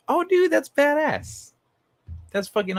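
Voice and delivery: hyped-up praise; excited. Real, not acted. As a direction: gush over the news. Excited reaction to first suit.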